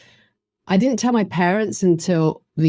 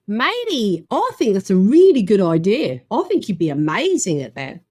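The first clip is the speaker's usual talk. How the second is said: put-on an upbeat Australian accent, opening higher; 5 semitones higher than usual. Australian friend Simone backing the career change, in an Australian accent. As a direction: do her in a cheery Australian accent. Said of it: "excuse the Australian accent".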